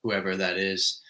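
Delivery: ordinary delivery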